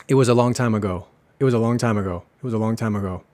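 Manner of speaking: "It was a long time ago" run together fast; fast casual English. Shown as a demonstration. Teacher showing sloppy fast English to learners. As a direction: say it quickly, linking every word. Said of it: "si lo digo rápido".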